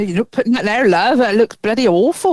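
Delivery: put-on grumbling local with a Dorset accent. A Dorset local objecting to Stonehenge, in a put-on local accent. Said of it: "they probably sound more like they would down here".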